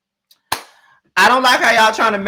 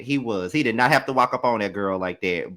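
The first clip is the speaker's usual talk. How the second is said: ordinary talk, nothing marked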